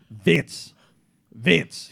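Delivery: imitated gruff Texan boss; Joe Robo. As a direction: call the name like an old Texan boss. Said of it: "He would say".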